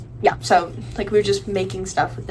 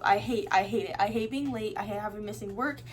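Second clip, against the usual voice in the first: ordinary talk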